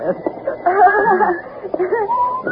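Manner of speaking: high, sustained fake laughter; forced social laugh. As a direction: laugh as if with an old friend. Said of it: "you will laugh and talk".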